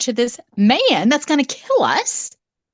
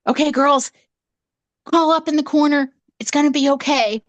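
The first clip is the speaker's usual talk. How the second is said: mom reassuring; 6 semitones higher than usual. Retold or imitated. Mom calming the girls.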